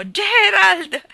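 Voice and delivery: a piercing, high, loud scream of a name; anguished cry. Acted. Geraldine calling her brother in the lake, anguished scream. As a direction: shriek it in terror for a drowning brother. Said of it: "con tono straziato".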